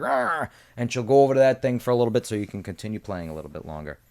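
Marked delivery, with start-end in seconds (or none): 0.0-0.5 sudden high annoyed arr; mock exasperated teacher cry; frustrated teacher growl. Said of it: "and she's like".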